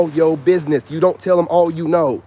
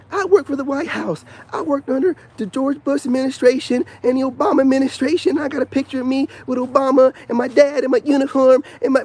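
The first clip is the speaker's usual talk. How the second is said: raised voice, showing off his credentials; mock a show-off posting online; mock internet bragger; 6 semitones higher than usual. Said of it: "people do this on the internet".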